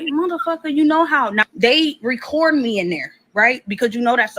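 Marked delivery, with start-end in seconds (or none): 0.0-1.3 higher, biting comeback; exasperated retort. Retold or imitated. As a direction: snap back that he knows. Retold retort to boyfriend.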